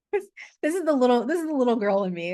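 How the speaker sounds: put-on high, quick little girl voice. Shown as a demonstration. Coach showing the group her inner little girl's voice. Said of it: "and she talks like this".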